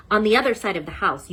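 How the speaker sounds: ordinary delivery, nothing marked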